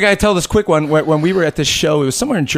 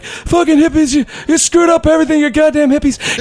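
put-on drunk Tim ranting; 12 semitones higher than usual. Drunk Tim yelling at a biker couple, high mocking rant. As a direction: rant like a hammered label boss. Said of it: "And Tim's like".

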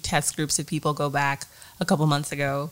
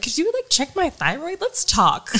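high, eager mock request; eager patient; 11 semitones higher, 4 dB louder than usual. Teacher jokes with doctor during covid test, high eager tone. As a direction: beg the doctor playfully. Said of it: "I was like".

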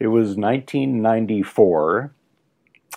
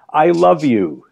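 a loud, high-pitched "I love you" called across the room; announcing love loudly to a friend at another table; retold loud declaration; 9 semitones higher, 8 dB louder than usual. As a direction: call it out across the restaurant at full voice. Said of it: "says very loudly".

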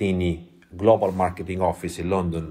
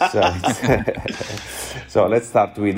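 ordinary talk

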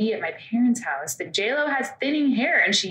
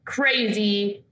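ordinary talk, nothing marked

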